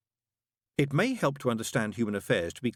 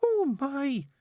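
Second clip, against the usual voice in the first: high, bright-voiced chattering nun; fussy nun. A narrator's reading. Nun greeting the father in a high voice. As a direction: pitch it high like a flustered nun. Narrator voices Sister Mary.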